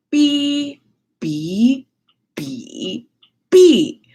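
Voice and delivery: beeps sung on jumping pitches like the tones on the CD; imitating tone beeps. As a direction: mimic the four tones as beeps. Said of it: "the C D rom is going".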